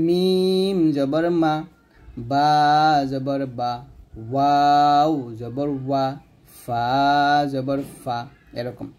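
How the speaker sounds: slow recitation of the four lip letters; slow letter drill. Shown as a demonstration. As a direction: drill each syllable slowly with clear lip shapes. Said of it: "এই চারটে হরফ মনে রাখবেন". (ধীরে ধীরে চার হরফের অনুশীলন)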